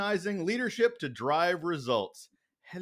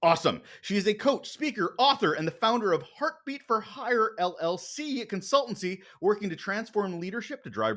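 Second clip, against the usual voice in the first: bright podcast host intro, higher and louder; announcer intro; 7 semitones higher, 4 dB louder than usual. Acted. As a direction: hype up the guest like an announcer. Show intro over music.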